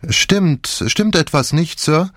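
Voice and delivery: a nosy maid, barely hiding excitement; eager maid. A narrator's reading. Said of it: "zitterten wie vor Aufregung".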